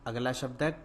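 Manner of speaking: usual speaking voice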